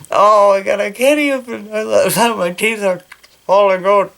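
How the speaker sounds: high, giggly burst of delight; giggling delight. Real, not acted. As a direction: laugh through the words, thrilled. Giggling at her own cosplay teeth.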